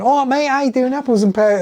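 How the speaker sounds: high, loud mock Cockney accent; Oliver Twist Cockney. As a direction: ham up a London Cockney voice. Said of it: "I best Oliver Twist that accent".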